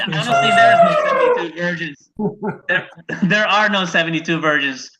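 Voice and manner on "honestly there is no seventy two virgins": high, loud squeaky falsetto; shrill put-on voice, played for laughs; squeaky mock voice. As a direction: falsetto blurt before the plain correction. Mock voice before correcting himself.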